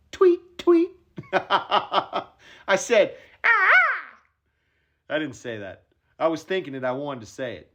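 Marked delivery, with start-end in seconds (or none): 0.1-0.9 squeaky, loud tweet tweet; high bird chirps. Retold or imitated. Imitating morning birds for Wahlberg, high chirp. 3.4-4.2 high loud squeal of glee; excited yelp. Pretend. Caddy's imagined squeal of joy at Wahlberg's line.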